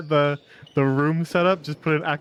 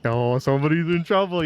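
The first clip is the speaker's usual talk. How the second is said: ordinary talk, nothing marked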